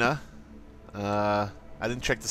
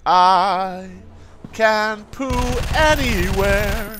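goofy high voice; 7 semitones higher, 5 dB louder, much slower than usual. Acted. Mock reading of game text.

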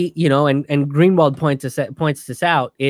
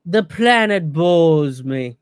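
mock bored billionaire; 3 semitones higher than usual. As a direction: play a jaded tycoon sneering at the planet. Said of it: "They're just like".

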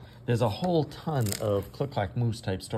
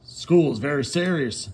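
higher, firm warning voice; stern Farmer Brown; 5 semitones higher, 7 dB louder, slower than usual. Acted. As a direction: lay down the rule. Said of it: "said Farmer Brown".

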